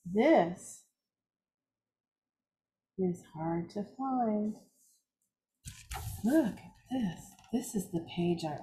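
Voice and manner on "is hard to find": usual speaking voice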